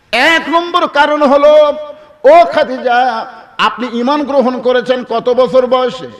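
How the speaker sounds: high, loud, drawn-out address; dramatic preaching. Acted. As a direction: call out the opening slowly, then press the question. Preacher voices Prophet's question. (উঁচু গলায় টেনে টেনে ওয়াজ)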